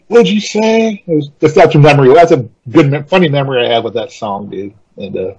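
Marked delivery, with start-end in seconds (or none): 0.1-1.0 higher, mock-scandalized question; shocked question. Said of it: "they all looked at me like".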